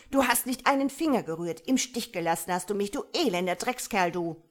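loud, high, fast tirade; screeching. A narrator's reading. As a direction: rail at your husband at full tilt. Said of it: "kreischte sie ihn an". (wütend kreischend)

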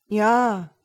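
put-on slightly let-down child, loud; disappointed. A narrator's reading. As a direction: answer with a touch of disappointment. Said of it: "sagte Alröhnchen etwas enttäuscht". (etwas enttäuscht)

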